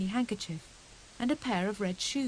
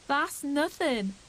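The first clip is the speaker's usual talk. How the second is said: slow, higher scornful scoff; 6 semitones higher, 3 dB louder, much slower than usual. Acted. Cousin Bill scoffing at Fuzzy Peg's boast, slow and scornful. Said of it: "said Bill scornfully".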